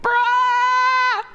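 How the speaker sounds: high "Bruh" into a crying laugh. Retold or imitated. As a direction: crack up at being short.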